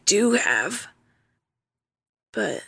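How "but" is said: soft whisper; whispered confession. Acted. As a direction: confide it under the breath. Confessing to his sister.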